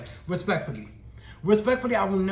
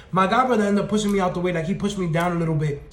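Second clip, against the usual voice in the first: ordinary talk, nothing marked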